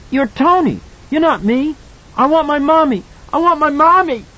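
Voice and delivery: put-on high loud panicked child; terrified child. A narrator's reading. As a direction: cry for mommy in terror.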